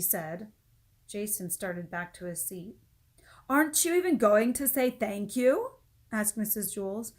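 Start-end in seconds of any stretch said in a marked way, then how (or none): 3.5-5.8 higher, louder, expectant; teacher nudging for thanks; teacher prodding. Acted. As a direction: demand manners.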